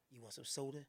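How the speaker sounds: very low, soft offer; deep lover voice. Pretend. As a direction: croon it low and smooth.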